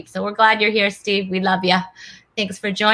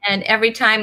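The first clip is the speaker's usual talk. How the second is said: ordinary talk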